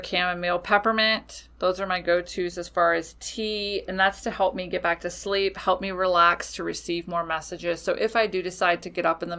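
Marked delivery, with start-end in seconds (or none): none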